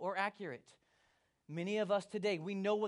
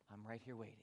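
low, soft and patient; calm, patient reply; 9 semitones lower, 13 dB quieter, faster than usual. Acted. God answering those upset with Him, calm. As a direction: drop to a quiet, gentle answer.

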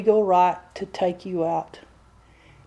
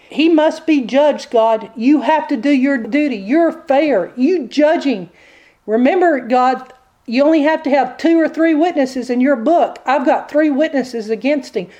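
higher, insistent prosecutor-devil; accusing devil; 4 semitones higher than usual. Acted. Devil demands that God judge Peter, accusing. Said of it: "The devil presents the evidence".

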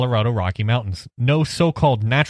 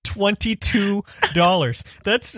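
slow, higher disbelief; disbelieving; 7 semitones higher, slower than usual. Real, not acted. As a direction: stretch it out in shock.

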